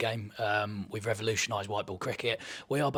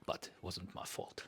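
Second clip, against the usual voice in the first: brooding South African; 6 semitones lower, 9 dB quieter than usual. Shown as a demonstration. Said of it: "sounds dark, sounds brooding".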